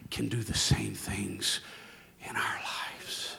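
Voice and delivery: slow, fading to a whisper. Acted. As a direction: let it sink in softly. Sermon fades to whisper.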